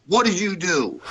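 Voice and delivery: high-pitched accusing question; mock angry interrogation; retold angry demand. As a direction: imitate a pissed-off boss demanding answers. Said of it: "He's pissed".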